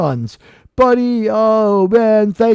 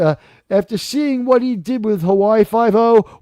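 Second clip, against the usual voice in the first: usual speaking voice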